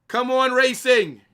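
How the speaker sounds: loud, high, drawn-out cheer for Racing; shouted cheer. Real, not acted. Streamer urging Racing on mid-match, loud shouted cheer. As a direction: yell it at the screen like a fan.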